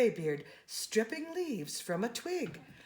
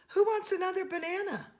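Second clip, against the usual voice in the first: bright, quick, much higher sing-song offer; cheerful call to chimps; 10 semitones higher, faster than usual. Acted. Jane calling chimps over with a banana, high and quick. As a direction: tempt them with a treat.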